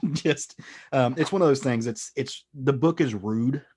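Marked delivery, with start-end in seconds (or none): none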